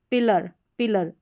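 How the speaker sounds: "पिलर" ending in a short, unstressed "-lər"; short schwa ending. Shown as a demonstration. Teacher reads out a word with the A-R ending, short schwa. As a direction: say "पिलर" with a weak "uh" before the r. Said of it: "अ sound होगा".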